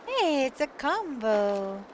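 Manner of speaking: cheery greeting. Real, not acted. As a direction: greet a friend with delight. Greeting a game character playfully.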